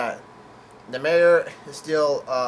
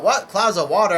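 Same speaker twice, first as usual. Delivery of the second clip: slightly raised, questioning mock yell; retold yell; 5 semitones higher, 6 dB louder than usual. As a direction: call out the question, half yelled. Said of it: "the Straw Hats yell out".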